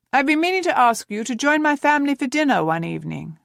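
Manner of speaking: friendly captain extending an invitation; lightly voiced captain. A narrator's reading.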